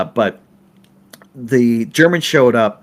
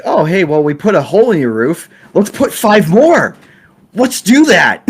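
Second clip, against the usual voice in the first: higher, louder mock carefree; hammed-up careless film crew; slowed mock cheer; cocky crew quote; 6 semitones higher, 5 dB louder than usual. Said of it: "they're like".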